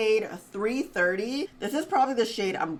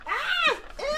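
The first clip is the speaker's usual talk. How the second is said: startled scream. Real, not acted. Spider suddenly appears.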